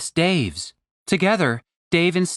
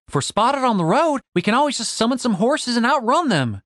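lightly voiced, higher-pitched confident woman; Stacy; 7 semitones higher than usual. Stacy pitching her escape plan to the group, lightly voiced and confident. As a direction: pitch it up a little, sure of the plan.

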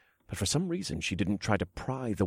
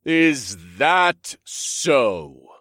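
very slow thunderous voice; giant booming voice; 5 semitones higher, 6 dB louder, much slower than usual. Acted. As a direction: drag it out like echoing thunder. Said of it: "A deep voice echoed like thunder".

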